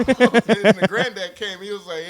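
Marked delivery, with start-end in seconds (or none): none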